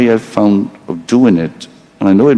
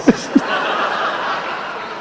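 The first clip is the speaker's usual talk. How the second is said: long wheezing giggle; soft breathless laughter; breathy laugh; 8 dB quieter than usual. Real, not acted. Laughing after forgetting gag.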